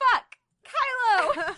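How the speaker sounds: loud, high hammed-up howl. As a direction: wail the name out in mock ecstasy. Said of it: "you howled".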